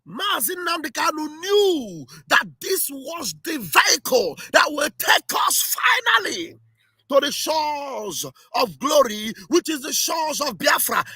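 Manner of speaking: high, exultant rally-speech proclamation; impassioned excitement. Real, not acted. Supporter proclaiming the leader foresaw it all, impassioned. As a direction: proclaim it like a fired-up preacher. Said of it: "why am I sounding this way".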